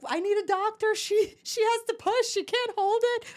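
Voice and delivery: high, loud frantic husband; panicked shout. Retold or imitated. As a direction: yell for a doctor in a panic. Panicked husband calls doctor.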